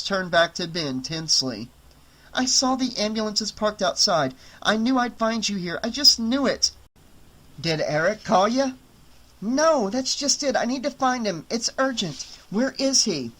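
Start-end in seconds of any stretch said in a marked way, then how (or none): 2.3-13.3 Jess's voice. Acted. Jess urgently searching for Eric.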